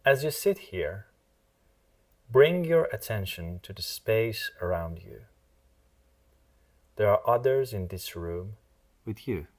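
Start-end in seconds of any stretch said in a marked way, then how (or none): none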